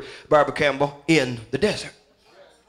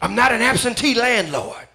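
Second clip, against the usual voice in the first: rising, louder chant of finds; fiery revival preaching; building preacher's litany; 8 semitones higher, 4 dB louder than usual. Acted. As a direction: hammer each line higher. Preaching at full force.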